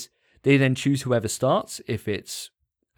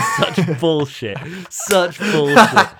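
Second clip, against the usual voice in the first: laughing outburst; 5 semitones higher, 8 dB louder, much slower than usual. Real, not acted. Mocking outburst while laughing.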